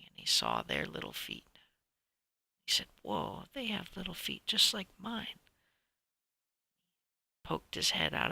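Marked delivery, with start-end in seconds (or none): 3.0-5.4 amazed whoa. Acted. Sees someone with little feet.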